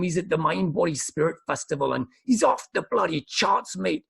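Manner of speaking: put-on a so-so Irish accent, bursting with excitement. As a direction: gush in a high, eager Irish accent. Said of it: "I don't know if that's the right accent. He's Irish".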